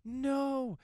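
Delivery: high, loud, drawn-out movie wail; mock anguished cry. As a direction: parody a hero crying out in horror. Retold movie scream at explosion.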